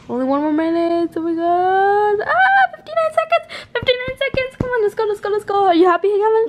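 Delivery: high eager urging; excited. Real, not acted. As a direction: hype up the last minute. New year countdown anticipation.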